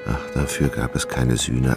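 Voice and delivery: normal talking voice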